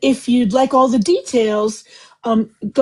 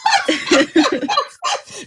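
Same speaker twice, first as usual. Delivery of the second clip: burst of laughter; 11 semitones higher than usual. Real, not acted. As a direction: crack up at the joke. Laughing at Nicolas Cage.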